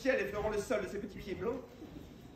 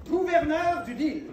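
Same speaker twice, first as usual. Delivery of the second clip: grandiose proclamation; 6 semitones higher, 7 dB louder, slower than usual. Acted. Grand promise to squire.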